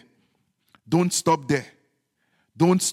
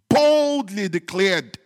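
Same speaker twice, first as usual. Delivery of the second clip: slow, ringing proclamation, building higher and louder; bold declaration; 4 semitones higher, 6 dB louder, slower than usual. Real, not acted. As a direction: stretch BOLDLY and hit it hard. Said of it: "the child of God is bold".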